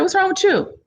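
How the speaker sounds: high, puzzled question; a hint of a worried business partner asking; concerned partner. Partner noticing her shocked face, high and puzzled.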